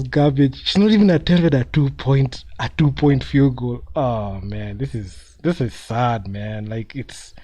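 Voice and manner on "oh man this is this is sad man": ordinary talk, nothing marked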